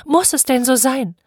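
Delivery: high, pleading question; mother crying out. Acted. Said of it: "rief sie". (flehend, mit hoher Stimme)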